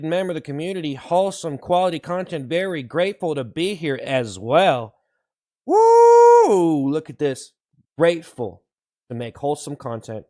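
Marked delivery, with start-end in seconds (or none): 5.7-6.9 high, loud whooping cheer. Acted. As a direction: whoop it out like a hype man. Streamer cheering on wholesome stream.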